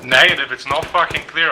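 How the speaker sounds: annoyed. Real, not acted. Arguing the building isn't clear.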